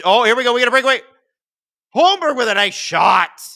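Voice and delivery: loud, high, fast game call; excited play-by-play. Real, not acted. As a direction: call the breakaway at full volume.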